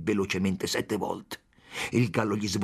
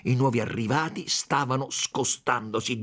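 ordinary delivery

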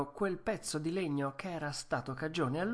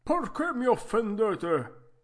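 vecchietto furioso, voce forte e acuta; imbestialito; 8 semitones higher, 6 dB louder, slower than usual. A narrator's reading. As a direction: sbotta lentamente, alto e forte, offeso. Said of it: "gli disse imbestialito". (imbestialito, a voce alta)

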